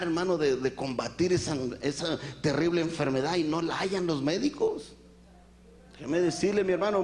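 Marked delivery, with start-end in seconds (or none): none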